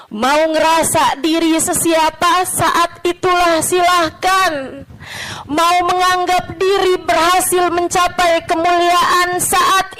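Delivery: high, rising oratory with drawn-out endings; impassioned preaching. Real, not acted. Preacher challenging listeners about pride, impassioned refrain. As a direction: hammer the refrain, building each round.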